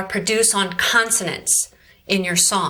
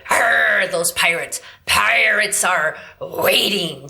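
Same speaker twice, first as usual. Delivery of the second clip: throaty slow pirate snarl; growled pirate; slower than usual. Shown as a demonstration. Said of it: "And we go".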